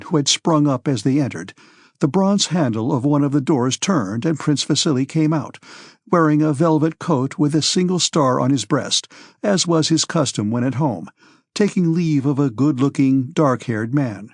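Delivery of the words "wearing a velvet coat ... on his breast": plain reading voice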